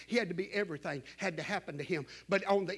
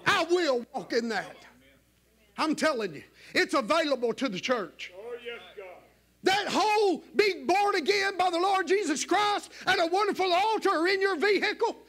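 high, loud fiery preaching; preaching at full force; 10 semitones higher, 5 dB louder than usual. Acted. Preacher stirring up the church, loud and high. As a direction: shout it out to the whole church.

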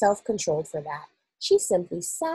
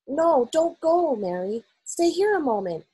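urgent plea; 8 semitones higher, 3 dB louder than usual. A narrator's reading. Queen asks maid to stay, urgently pleading. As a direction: stop someone from leaving.

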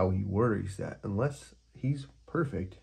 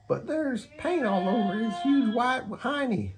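high, louder mocking parakeet; Parakeet voice, teasing; 4 dB louder than usual. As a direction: giggle at his painted bottom. Parakeet character voice.

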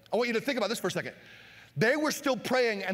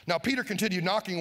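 usual speaking voice

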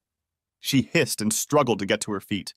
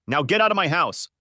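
higher, forceful order, almost shouting; near shout; 7 semitones higher than usual. A narrator's reading. Husband ordering cheating wife out, nearly shouting. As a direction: throw her out with a raised voice. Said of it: "I practically shouted".

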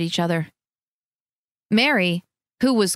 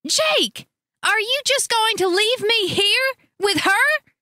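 much higher, slow, whining wail; 13 semitones higher, slower than usual. A narrator's reading. Wife begging husband not to leave, wail. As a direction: plead in a rising whine. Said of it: "Teresa wailed".

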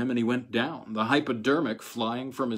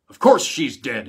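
a much louder bark; 2 semitones higher, 11 dB louder than usual. A narrator's reading. Colonel snaps at the dazed attendant, loud bark. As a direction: bark it like a gruff officer. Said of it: "he barked".

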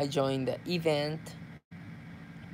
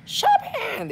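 very high, whiny shout; whiny shopping wail; slower than usual. Retold or imitated. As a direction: wail like a shopping-starved fan. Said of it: "That's why I'm always".

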